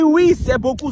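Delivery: high, very loud mock uncle; hammed-up uncle bragging; uncle's voice. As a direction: boast it to the woman. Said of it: "Il dit".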